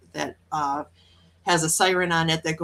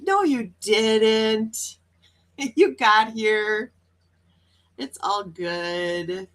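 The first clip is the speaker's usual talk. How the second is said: reassuring; much slower than usual. Real, not acted. Reassuring viewer who almost missed.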